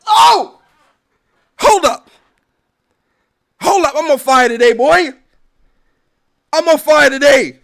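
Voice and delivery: loud, high hype shout; on fire today yell; excited yell. Real, not acted. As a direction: shout like you just caught fire. Excited outburst at mistake.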